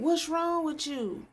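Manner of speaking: high, loud mock scolding; retold mother's yelling. Imitating a mother yelling at her two-year-old, high and loud. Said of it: "the mama started yelling at the child".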